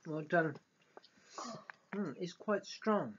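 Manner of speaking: ordinary talk, nothing marked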